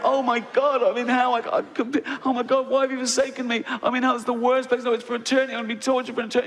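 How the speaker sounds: panicked believer in hell. Retold or imitated. Fundamentalist waking in hell, high panicked wail. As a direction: squeal in mock terror at waking in hell. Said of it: "You want to go".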